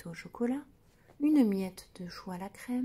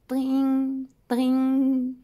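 ringing phone imitation; 3 semitones higher, 9 dB louder than usual. A narrator's reading.

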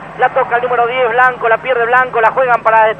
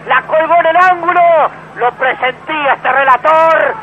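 goal commentary; 5 semitones higher than usual. Real, not acted. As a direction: shout the goal in triumph. Free kick goal call.

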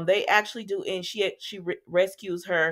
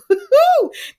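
loud laugh; 13 dB louder than usual. Real, not acted. Laughing at the scene.

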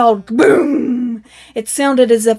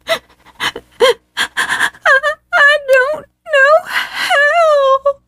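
much higher, slow, crying voice; sobbing; much slower than usual. A narrator's reading. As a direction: sob the words out, heartbroken. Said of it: "sobbed Attila".

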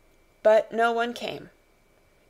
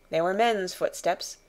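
ordinary delivery

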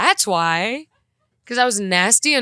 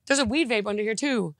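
ordinary talk

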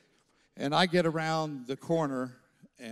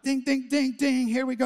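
excited ding; 10 semitones higher, 4 dB louder than usual. Retold or imitated. Excited inner alarm.

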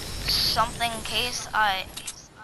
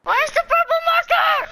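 much higher, loud panicked question; frantic high shout; 7 dB louder than usual. Real, not acted. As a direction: yell it in confusion. Frantically searching for the marker.

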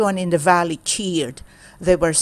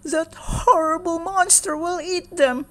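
high, tearful complaint; lightly weepy farmer voice; weeping; 10 semitones higher than usual. Acted. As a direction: lament the monster in a weepy voice. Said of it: "Ahmed wept".

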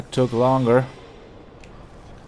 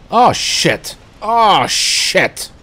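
loud, high alarmed yells; real panicked yelling; panicked shouts; 9 semitones higher, 12 dB louder, much slower than usual. Real, not acted. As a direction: cry out as danger closes in. Enemy attacking, panic.

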